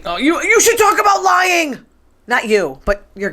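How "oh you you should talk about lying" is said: indignant shout. Real, not acted. Host fires back at co-host John, indignant shout. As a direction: hit back at him hard. Arguing with John about lying.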